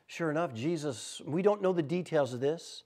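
ordinary delivery

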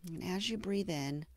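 gentle meditation guide with long, settling pauses; guided meditation. Acted. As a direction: ease the listener into self-compassion, unhurried and hushed at the phrase ends. Leads a mindfulness meditation.

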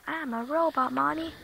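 high, soft robot voice. Pretend. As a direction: play a little robot. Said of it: "You sound like a robot".

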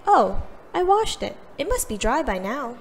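mother casually answers a frantic Emma; mother's voice. Acted. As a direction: toss it off lightly, unaware. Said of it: "Mother told her".

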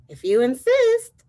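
high, loud, slow reply; praying mantis. Acted. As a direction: draw it out as a storybook mantis accepting. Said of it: "said the praying mantis".

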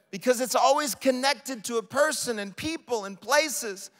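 normal talking voice

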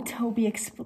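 ordinary delivery

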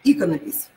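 Иконопись stressed on the opening И; Иконопись with strong И, the rest reduced; stress on first syllable. Shown as a demonstration. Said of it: "первый слог".

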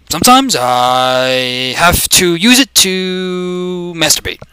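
halting statesman voice, stretched words and long ums; slow, deliberate put-on politician voice; mock presidential confession. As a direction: confess solemnly like a politician at a podium. Plays Obama giving a confession.

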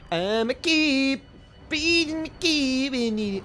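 high, slow slurred "I'm a keep"; mock cop. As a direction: mumble the cop line slowly. Garbled cop bit.

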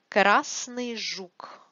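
Красный жук drawn out slowly, КРАСный stressed; slow Russian demo. Shown as a demonstration. Teacher repeats the phrase slowly for students.

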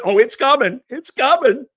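high, loud, gleeful mock voice; Bill Gates. Mocking a giggling billionaire announcing a new plague, high giddy voice. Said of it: "a giggling Bill Gates talks about the new plague".

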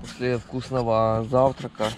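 ordinary talk, nothing marked

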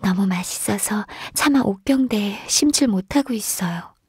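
ordinary talk, nothing marked

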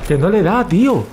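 frustrated shout. Real, not acted. Shooting match, enemy not hit.

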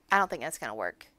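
usual speaking voice

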